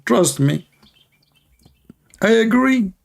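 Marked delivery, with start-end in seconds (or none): 2.2-2.9 mock voice. Mocking opponent's claim.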